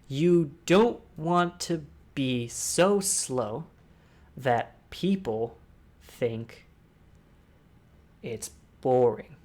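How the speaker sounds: dragged-out slow delivery. Shown as a demonstration. Speaker showing how too-slow speech sounds. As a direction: stretch every word out to show boring. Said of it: "if I spoke like this".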